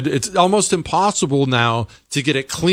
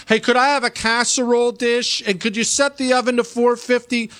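mocking Chris; 9 semitones higher than usual. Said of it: "are you gonna borrow the oven".

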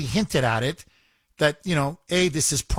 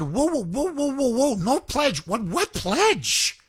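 higher, startled whoa whoa, halting the room; alarmed objection; 8 semitones higher, slower than usual. Pretend. Caller imagining the crowd protesting the pledge, raised startled pitch. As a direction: stop them mid-step, in disbelief.

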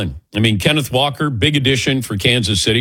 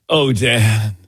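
breathy voice. Retold or imitated. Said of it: "in that breathy voice".

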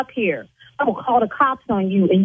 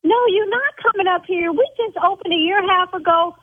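much higher, yelling back down the phone; high-pitched yelling; 6 semitones higher than usual. Acted. Salon owner yelling at a threatening caller, higher and outraged. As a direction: shriek it at him, outraged he threatened the shop. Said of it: "See, now I'm yelling".

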